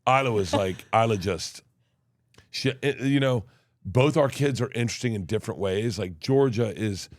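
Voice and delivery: ordinary talk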